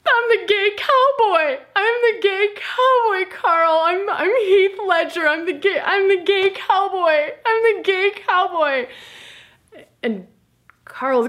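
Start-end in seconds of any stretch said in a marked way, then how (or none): 0.1-8.9 high, loud sobbing confession; wailing. Retold or imitated. Confessing to his chiropractor, loud high wailing sobs. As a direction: wail it out, hammed-up despair. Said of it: "I am wailing".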